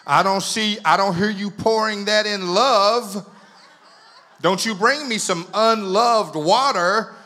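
loud, slow, hammed-up call to the kitchen; husband hollering down to his wife in the kitchen, mock-loud and slow; mock shout down the stairs. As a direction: playfully bellow his complaint down the steps. Calling downstairs to wife.

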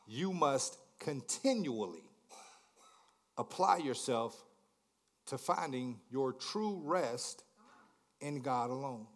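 slow, deliberate words for note-taking; slow dictation. Shown as a demonstration. Preacher slowly dictating the key truth to his congregation, measured and deliberate. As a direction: spell it out slowly so they can write. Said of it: "I want you to write this one down".

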